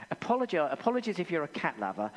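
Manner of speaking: ordinary talk, nothing marked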